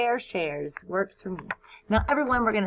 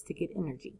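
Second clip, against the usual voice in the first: whisper; 5 semitones lower, 10 dB quieter, much faster than usual. Acted. Whispered aside.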